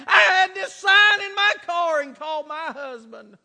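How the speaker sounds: loud, high retold outburst, easing at the end; fervent retelling. Sign-holding woman telling the preacher her story, fervently retold. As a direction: burst out loudly, retelling her resolve.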